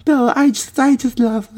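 very high, mocking whiny voice; mocking braggart. Mimicking the bragger's gushing in a very high voice. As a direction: squeak it like a spoiled show-off.

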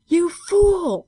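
high, loud horrified yell; appalled outburst. A narrator's reading. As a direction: cry out at his blunder. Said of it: "stammered Bob".

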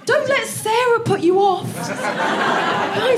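high, slow, laughing plea; high pleading. Acted. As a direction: beg the crowd, half laughing. Pleading with a quiet audience.

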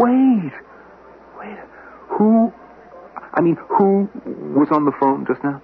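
high, breaking alarm; stammering in sudden panic. Acted. Frantic questions after hearing Carradine's name.

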